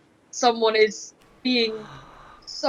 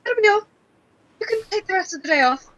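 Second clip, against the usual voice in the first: higher, hurried nervous brush-off; flustered dismissal; 9 semitones higher, faster than usual. Acted. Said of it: "and just goes".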